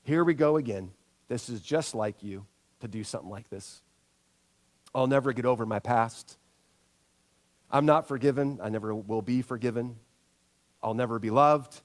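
the harsh self-talk of failure, pitched low; inner critic voice. Retold or imitated. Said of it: "we begin to believe".

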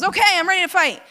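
high, loud, rushed eagerness; mock battle cry. Samson waking up to fight the Philistines, mock battle cry. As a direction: burst out raring to go.

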